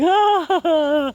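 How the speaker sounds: short high cry, lightly hammed; mock dismayed cry. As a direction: groan in fake despair. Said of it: "this dude's just like".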